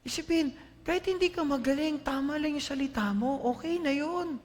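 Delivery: high, loud impassioned preaching; fervent sermon peak; preaching at full force. Acted. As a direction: drive the point home to the church. Preaching climax.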